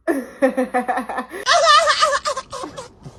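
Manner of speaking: high, loud reenacted cackling laugh; laughing in their face. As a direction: burst into uncontrolled high giggles. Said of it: "like, laughing in their face".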